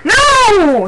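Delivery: very high, loud, drawn-out wail; anguished long yell; yowled cry. A narrator's reading. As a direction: cry out in horrified protest. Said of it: "he yowled".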